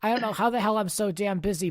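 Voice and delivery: ordinary talk, nothing marked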